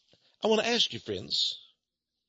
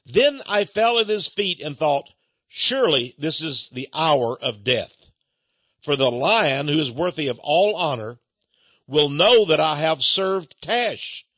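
louder, slow solemn reading of scripture-like prose; dramatic reading; 2 semitones lower, 4 dB louder than usual. Acted. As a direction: read it as a grave story. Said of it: "Now I'm reading from the book".

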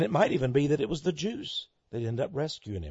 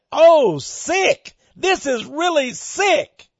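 high, loud mock scream of delight; gleeful scream; 10 dB louder, much slower than usual. Preacher imitating a girl opening birthday gifts, gleeful scream. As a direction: imitate a delighted squeal, stretching the last words. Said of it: "she screamed out in glee".

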